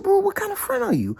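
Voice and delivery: very high, reproachful; shrill retold reproach; hurt indignation. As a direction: shame the friend. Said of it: "I said".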